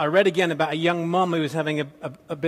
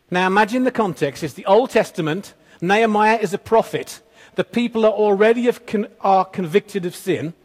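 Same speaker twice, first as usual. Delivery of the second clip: preaching at full force; 2 semitones higher, 5 dB louder than usual. Acted. Preacher rousing his congregation about the prophet, loud and emphatic. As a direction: push the point home to the congregation.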